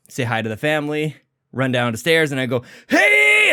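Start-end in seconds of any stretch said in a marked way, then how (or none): 2.9-3.5 put-on a high, loud hey that cracks like a twelve-year-old's; cracked morning hey. Shown as a demonstration. Host showing his unwarmed morning voice to listeners, loud and high, cracking. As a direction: yelp a hey before the vocal cords are warmed up. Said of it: "I got a little cracked voice".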